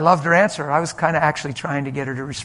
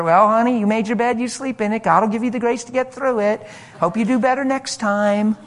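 mock-sympathetic brush-off, pitched up; higher, louder parody of pious scolding; dismissive platitudes; 7 semitones higher, 4 dB louder than usual. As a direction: imitate an uncaring, pat piece of advice. Mocking how others would dismiss her.

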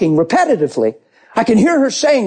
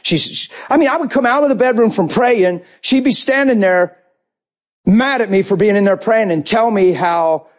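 ordinary delivery

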